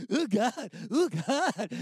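moved and embarrassed; a retold half-sob; overcome oh God. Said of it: "And I'm like".

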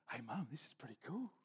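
hushed, breathless whisper of wonder; awed whisper. Acted. Eaglet gliding on the current, whispering in awe to mum. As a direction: whisper like you can't believe it.

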